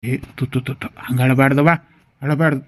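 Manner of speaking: low, hushed tut-tutting comfort; soothing. Acted. Father soothes his crying daughter.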